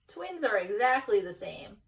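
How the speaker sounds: usual speaking voice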